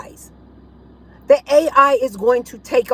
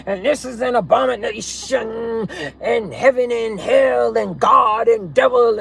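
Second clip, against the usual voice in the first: mock scripture quoting. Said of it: "he kept quoting the scripture and the Bible".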